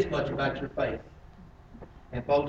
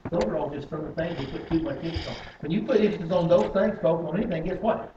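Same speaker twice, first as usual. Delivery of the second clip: ordinary talk, nothing marked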